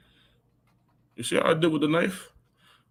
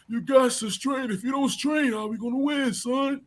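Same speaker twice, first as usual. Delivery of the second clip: much higher, slow hammed-up coach voice; imitating Coach Odell; 9 semitones higher than usual. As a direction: stretch it out high like a coach urging his player on. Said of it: "It sounds just like Odell".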